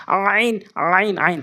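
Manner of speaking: আইন squeezed from the middle of the throat; throat ain drill. Shown as a demonstration. Teacher drills learners, pharyngeal আইন repeated. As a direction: constrict the throat to open each আইন. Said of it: "হলকের মাঝখান থেকে".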